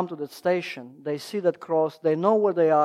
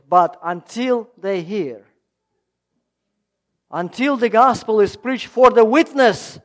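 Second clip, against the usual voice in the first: rising, insistent sermon lines; preaching appeal; 2 semitones higher, 7 dB louder than usual. Acted. Preacher appealing for gifts to the ministry, insistent. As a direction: stress the urgency with a louder push.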